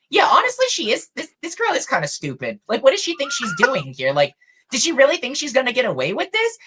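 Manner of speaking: high-pitched mock disbelief; incredulous scoffing. Watching humans judge the accuser, incredulous and high. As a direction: imitate scoffing onlookers, pitch leaping up.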